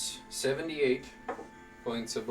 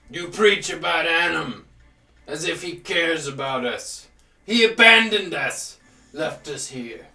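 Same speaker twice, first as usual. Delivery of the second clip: booming, furious giant preaching; fire giant voice; 6 dB louder than usual. Acted. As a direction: roar it like an angry giant. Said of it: "You hear the fire giant's voice".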